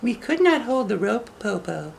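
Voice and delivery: put-on very high little girl voice. Shang teases the wolf from the treetop, high girl voice. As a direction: talk up high like a young girl. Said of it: "Shang said".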